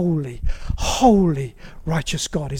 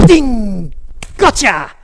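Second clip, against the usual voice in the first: loud, higher mock-gloating "Ding! Gotcha!"; the devil scoring a hit, hammed up; gloating enemy; 4 semitones higher, 7 dB louder, much slower than usual. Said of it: "the enemy gets his bow and arrows and he goes".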